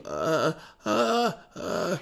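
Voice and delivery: creaky fry cracks on each "ah"; short vocal-fry cracking demo; cracking onsets. Shown as a demonstration. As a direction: let the voice crack into each attack. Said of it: "du cracking".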